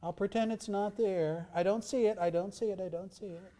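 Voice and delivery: high, airy pretending voice; mock God ignoring. Preacher mocking the idea of God ignoring sin, in a high pretending voice. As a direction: act like someone looking away from the debt. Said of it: "Does he just go".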